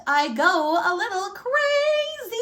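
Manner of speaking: loud, high, drawn-out outburst; going crazy. A narrator's reading. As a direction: build to a crazed, stretched-out last word. Wolf losing his temper over granny.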